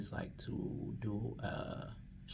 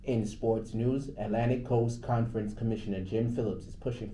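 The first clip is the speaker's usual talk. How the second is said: sports news read; 6 dB louder than usual. Real, not acted. DJ reading sports on air, announcer voice.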